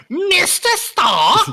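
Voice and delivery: high, loud, slow exclaim "Mister Stark"; mock woman's voice. Mocking audiobook female voices, high and loud. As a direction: shriek it like a lady in a book. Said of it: "'Cause I was like".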